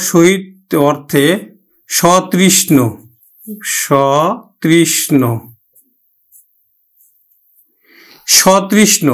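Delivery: সতৃষ্ণ with an open অ on স, said very slowly; slow model word. Shown as a demonstration. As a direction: keep স in সতৃষ্ণ open, not ও. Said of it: "সটার উচ্চারণ স এর মতোই হবে".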